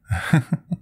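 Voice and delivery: a light, breathy little laugh up high. Acted. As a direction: laugh gently at your own silly question. Servant laughs softly at own question.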